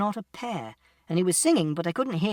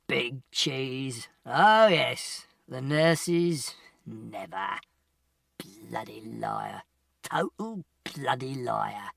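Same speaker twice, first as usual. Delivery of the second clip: put-on rambling drunk; 5 semitones lower, much slower than usual. Drunk stranger standing over narrator, incoherent muttering.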